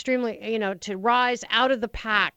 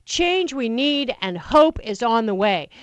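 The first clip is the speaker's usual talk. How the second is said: slow, louder, "hope" leaned on; campaign slogan; 3 dB louder, slower than usual. Shown as a demonstration. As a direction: sell the slogan to the crowd. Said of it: "You've gotta say".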